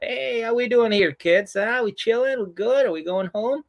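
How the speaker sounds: high, upbeat string of questions; mock cheery check-in. Brother checks if the kids are good, mock cheerful. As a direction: breeze in chirpily as the hopeful peacemaker.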